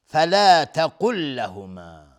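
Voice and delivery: فلا تقل لهما chanted slow and loud, the ق of تقل said thick and heavy; heavy qaf. Shown as a demonstration. Teacher shows the heavy qaf, chanted slowly. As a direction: stress a full, heavy ق in تقل.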